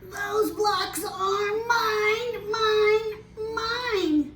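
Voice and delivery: put-on high, drawn-out whiny toddler voice; Grumpy Toad whining to keep his truck. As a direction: whine it like a toddler hogging a toy. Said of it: "Grumpy Toad starts to whine".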